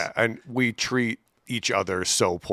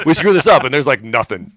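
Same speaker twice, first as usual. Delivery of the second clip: louder and higher, pushing the energy up; bringing the energy up; 6 semitones higher, 11 dB louder than usual. Real, not acted. Answering the call for energy, suddenly louder and higher. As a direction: force some energy into it. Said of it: "Sorry to bring the energy down".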